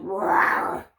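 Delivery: loud mock dragon growl; growled roar. Dragon growling at the horses, loud mock growl. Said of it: "He growled".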